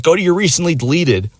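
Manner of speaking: high, mock female order; imitating suspicious girl.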